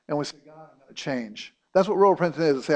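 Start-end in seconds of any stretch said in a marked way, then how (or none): 0.4-1.5 much softer, hushed promise to God; quiet prayer vow. Acted. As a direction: murmur the vow under your breath. Voicing a repentant prayer.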